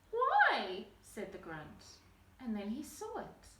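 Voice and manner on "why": surprised. Acted. Grunt surprised and upset.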